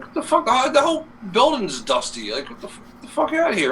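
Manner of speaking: high-pitched mock disbelief; retold exasperation. Worker retelling his answer to the dusty excuse, exasperated. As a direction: act out scoffing at a lame excuse.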